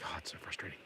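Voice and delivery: breathy whispered complaint; his own scrambling brain, whispered; frustrated whisper. Retold or imitated. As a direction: whisper it like a thought you can't say aloud. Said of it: "and it's like".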